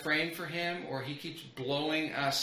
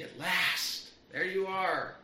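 put-on higher, tearful little girl's relief; lightly sobbing Lucy; 5 semitones higher, slower than usual. A narrator's reading. Lucy finally finding Aslan in the grove, mildly tearful. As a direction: let a hint of tears in, voicing young Lucy. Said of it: "sobbed Lucy".

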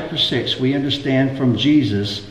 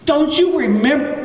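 high-pitched, incredulous exasperation; acted exasperation; 12 semitones higher than usual. Jesus to his disciples in the boat, exasperated. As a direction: play a teacher at his wit's end, voice pitched way up. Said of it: "because it says he was exasperated".

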